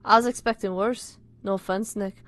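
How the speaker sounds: wandering, amateur Scouse; all-over-the-place accent. Acted. Friend describing dad's photo, wandering Liverpool accent. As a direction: Liverpool accent that keeps slipping. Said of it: "My accent's going everywhere".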